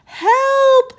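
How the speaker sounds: high, loud cry for help; yell for help. A narrator's reading. Andrea drowning in the ocean, yelling for help. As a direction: shout for rescue. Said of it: "yelled Andrea".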